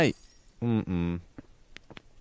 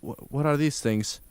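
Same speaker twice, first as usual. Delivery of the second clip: normal talking voice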